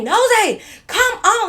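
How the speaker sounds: high, loud impatient holler; retold calling out. Woman hurrying her friend into the car, impatient holler.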